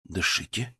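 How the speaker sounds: soft, slow, soothing voice; calm her down gently; soft spa whisper. A narrator's reading. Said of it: "мягким, успокаивающим голосом".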